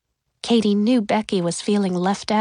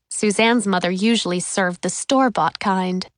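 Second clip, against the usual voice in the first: ordinary delivery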